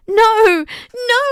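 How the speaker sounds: high, loud tearful no; child weeping in the dark; weeping cry. A narrator's reading. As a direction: cry it out in despair. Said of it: "I wept".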